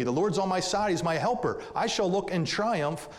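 impassioned pulpit voice; full-force preaching. Acted. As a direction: drive the verse home to the congregation.

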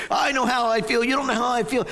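high, indignant protest; upset kid. Retold or imitated. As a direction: insist like a hurt boy. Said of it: "that sense of".

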